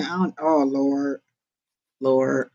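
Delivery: ordinary delivery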